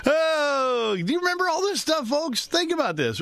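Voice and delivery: excited. Real, not acted. Excited recalling old headlines.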